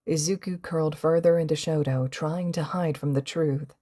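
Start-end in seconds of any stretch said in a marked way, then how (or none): none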